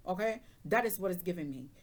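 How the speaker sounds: normal talking voice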